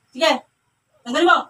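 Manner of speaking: loud, urgent order; forceful command. Real, not acted. Repeats order forcefully to the spirit. (malakas at mariing utos)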